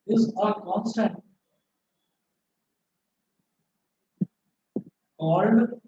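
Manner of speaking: slow dictation, word groups split by long pauses; classroom dictation. Real, not acted. Teacher dictating a physics rule to students, slow dictation. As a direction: dictate slowly so students can write.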